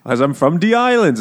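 comic island Jamaican voice; Jamaican accent. Acted. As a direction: defend yourself in a put-on Jamaican accent. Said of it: "Actually, he's Jamaican".

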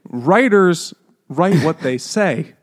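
slow and loud, every word leaned on; emphatic slow insistence. Real, not acted. Arguing with the book club, slow loud insistence. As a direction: hammer "Writers write what they say" home word by word. Insisting on how writing works.

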